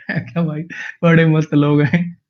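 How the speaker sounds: spoken through laughter, slow; laughing. Real, not acted. Teacher laughs at the meme with his class. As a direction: let the laugh spill into the words.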